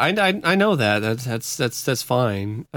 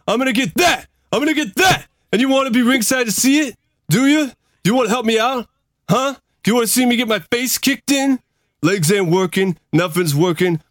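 higher, louder Rocky voice, bitter and pleading; Rocky venting at his old trainer, pitched up and loud; Rocky impression; 6 semitones higher, 3 dB louder than usual. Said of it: "So this is a Rocky quote".

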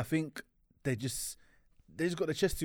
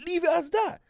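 high exasperated; 5 dB louder than usual. Real, not acted.

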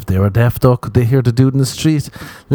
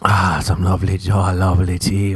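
mock ASMR tea sip; 3 semitones lower than usual. Sipping tea in ASMR mode.

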